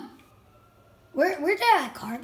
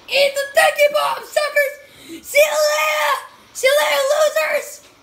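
gleeful shouting taunt; 8 semitones higher than usual. Real, not acted. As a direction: jeer at them as you escape. Taunting enemies while fleeing in game.

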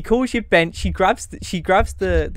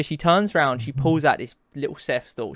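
ordinary talk, nothing marked

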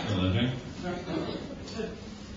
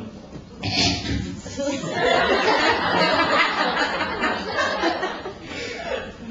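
mock laugh. Inner reaction to ugly shoes.

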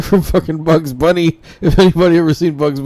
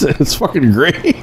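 usual speaking voice

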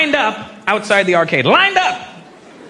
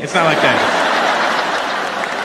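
ordinary delivery

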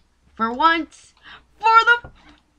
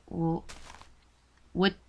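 usual speaking voice

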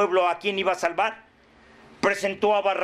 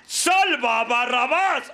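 slow, drawn-out mock yell; acted crowd shout; 6 semitones higher, 3 dB louder, slower than usual. The crowd answers Pilate, drawn-out mock shout. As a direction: cry it out long like a baying crowd. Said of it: "Y la conciencia grita".

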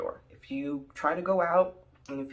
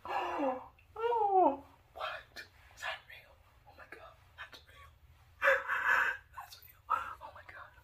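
hushed, stunned whisper; whispered disbelief. Retold or imitated. In the neighbor's house, whispering in shock. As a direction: whisper as if not to be heard.

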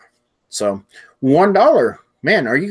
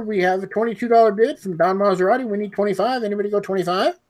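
rising auction finale; 6 semitones higher than usual. Acted. Closing the auction sale.

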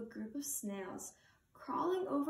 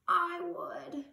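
a higher, louder creak of a voice; creaky coral; 6 semitones higher, 4 dB louder than usual. Acted. Crusty coral creaks a reply to Hermit Crab. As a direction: croak it out like old crusty coral. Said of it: "creaked a crusty coral".

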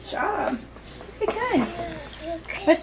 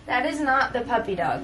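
ordinary delivery

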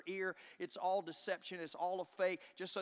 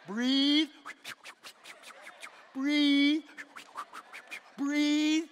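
louder coaching breathe, each word drawn out; coaching wife to breathe; 9 semitones higher, 12 dB louder, much slower than usual. Retold or imitated. Husband beside his wife in labour, urging her loudly to breathe. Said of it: "stand there and go".

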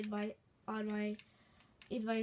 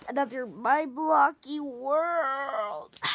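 drawn-out intro voice; 2 semitones higher, 10 dB louder, slower than usual. Acted. Slow drawn-out channel intro.

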